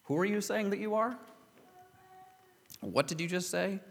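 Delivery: high-pitched, rising disbelief; incredulous intonation. Acted. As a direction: question it high, as if you misheard. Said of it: "the intonation is more like".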